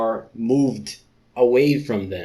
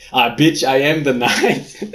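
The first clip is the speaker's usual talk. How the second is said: Batman voice; 3 semitones higher, 7 dB louder than usual. Pretend. Leopard man posing as Batman, hammed-up dramatic voice. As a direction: ham up a dramatic Batman line. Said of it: "you can call me Batman".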